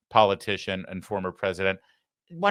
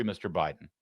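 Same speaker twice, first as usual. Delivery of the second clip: normal talking voice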